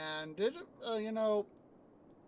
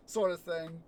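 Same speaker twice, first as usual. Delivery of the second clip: ordinary delivery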